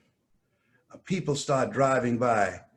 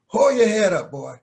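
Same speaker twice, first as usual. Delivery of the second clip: firm command; 7 semitones higher, 7 dB louder than usual. Retold or imitated. Mother commanding.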